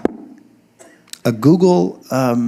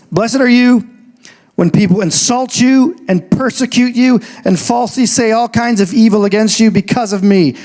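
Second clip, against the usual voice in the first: raised, emphatic reading of scripture; 8 semitones higher than usual. Real, not acted. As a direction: proclaim the verse to the congregation.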